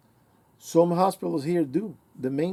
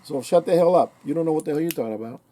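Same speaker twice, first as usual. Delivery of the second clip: loud, heated telling-off; angry retort; 2 semitones higher, 6 dB louder, faster than usual. Pretend. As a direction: let him have it, louder on each line. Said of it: "if I was my dad, I would have said".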